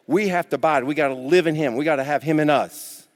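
ordinary talk

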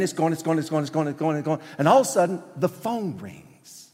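breathless-paced, insistent repetition; escalating argument. Acted. Preacher recreating a heated family row, mounting and urgent.

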